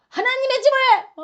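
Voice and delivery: high, loud indignant burst; outraged outburst. Acted. Jesus outraged at a den of robbers, angry high outburst. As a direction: burst out as if scandalized. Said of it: "막 화를 버럭 내고".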